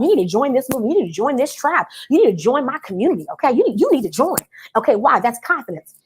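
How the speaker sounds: normal talking voice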